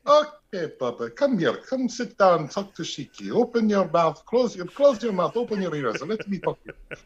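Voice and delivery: put-on high-pitched, accented wrestler off duty; Iron Sheik at home. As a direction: coax a loved one in a high Arabic-accented voice. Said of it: "Sheik at home".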